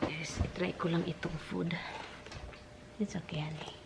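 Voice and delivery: whispering. Real, not acted. Soothing someone while eating.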